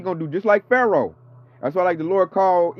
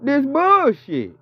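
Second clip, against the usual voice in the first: outraged drawn-out climax; 7 semitones higher than usual. Real, not acted.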